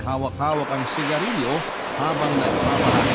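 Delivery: ordinary talk, nothing marked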